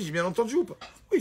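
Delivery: ordinary talk, nothing marked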